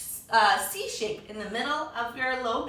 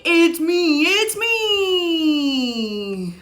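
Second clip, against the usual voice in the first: loud, high and gleeful; pig's reveal; 3 semitones higher, 10 dB louder, much slower than usual. Acted. Pig revealing itself under the mask, loud and gleeful. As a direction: shout it like a proud little pig. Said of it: "says the pig".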